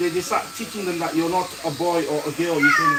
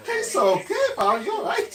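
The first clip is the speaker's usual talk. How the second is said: laughing mock reassurance; 14 semitones higher than usual. Mocking acceptance, laughing.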